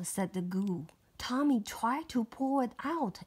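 ordinary delivery, nothing marked

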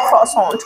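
croissant said the French way with no final T. Shown as a demonstration. Mum corrects her daughter's croissant, French style. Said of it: "French accent में".

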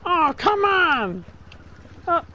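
high, drawn-out frustrated groan; raging gamer. Real, not acted. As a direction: stretch it out in exasperation. Gamer frustrated after a death.

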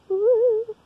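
high, loud cheer of joy; excited whoop. Real, not acted. Kid cheering to viewers about the new plush, a high loud whoop. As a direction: whoop with delight.

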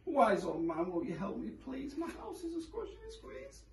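high, fading plea; pleading old lady. A narrator's reading. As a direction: beg softly, trailing away.

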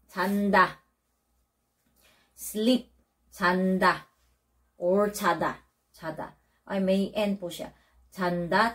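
ordinary delivery

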